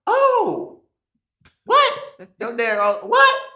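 high squeaky disbelieving what; stunned, voice jumping way up; incredulous high-pitched what; shocked disbelief. Retold or imitated. Shocked reaction to dad's claim.

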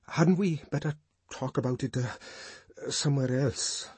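halting, self-conscious suggestion; uncomfortable stammer. A narrator's reading. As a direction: falter with embarrassment. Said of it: "he stammered, looking horribly uncomfortable".

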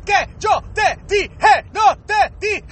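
each syllable punched out like a smack. Acted. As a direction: scold in Spanish, chopping every syllable apart. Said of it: "Especially when they mad".

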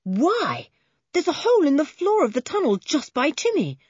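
rising to a high, startled exclamation; surprised cry. A narrator's reading. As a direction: exclaim at a sudden discovery. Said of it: "cried Julian".

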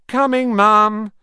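slow, high, loud call back. A narrator's reading. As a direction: answer Mum from far away. Twins call back to mother.

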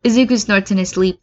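ordinary delivery, nothing marked

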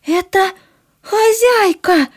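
put-on breathless, scared servant girl; gasping. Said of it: "задыхаясь, прошептала Бекки". (задыхаясь от страха)

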